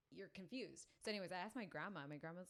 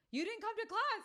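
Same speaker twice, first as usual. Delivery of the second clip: much higher, louder accusing cry; high mock scold; 14 dB louder than usual. Mentor catches her skipping class, loud mock scold. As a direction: call her out, hammed up.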